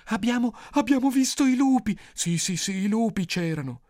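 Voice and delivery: put-on high, agitated child witnesses; excited children. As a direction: blurt it out like frightened children. Children report seeing wolves. (bambini agitati, voce acuta)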